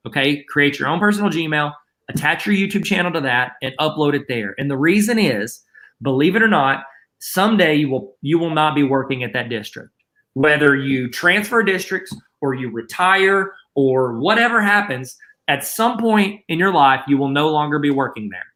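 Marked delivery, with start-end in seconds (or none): none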